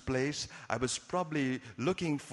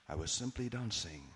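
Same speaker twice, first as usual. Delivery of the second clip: low, much softer, chilling murderer's voice; cold murderer voice; 8 semitones lower, 9 dB quieter than usual. Acted. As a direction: answer from the cold room, drained and low.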